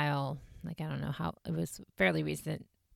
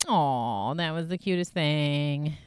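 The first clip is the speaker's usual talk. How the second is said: mock group "aw" at something cute; gushing imitation, sliding down on the aw; cooing; 4 dB louder than usual. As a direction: coo as if over a cute kid. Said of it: "they were all like".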